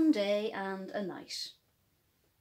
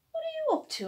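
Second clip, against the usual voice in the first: much higher, surprised daddy; curious daddy; much faster than usual. Acted. Daddy finding Hopscotch washing Rabbity. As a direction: pop the question up, curious dad. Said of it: "asked his daddy".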